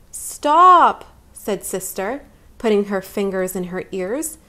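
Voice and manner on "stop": high, loud sharp stop; shouted stop. Acted. Little sister yelling at brother to stop the noise. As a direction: cut him off loudly.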